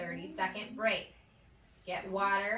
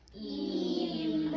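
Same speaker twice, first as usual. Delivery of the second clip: stretched out. Shown as a demonstration. Teacher stretching a blend for young readers, stretched out. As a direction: sound the word out slowly, drawn long. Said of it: "Let's stretch this one".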